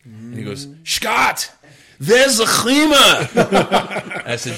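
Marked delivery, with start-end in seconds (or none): 0.8-3.0 high, loud mock-German outcry; [name]'s German accent. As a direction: exclaim in a badly faked German accent. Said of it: "My accents are a little off today".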